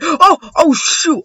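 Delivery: high, loud yelp of alarm; startled cry. Real, not acted. Gamer suddenly finding his opponent, loud startled yelp.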